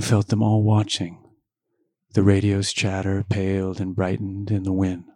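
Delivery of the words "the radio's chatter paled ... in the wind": ordinary delivery, nothing marked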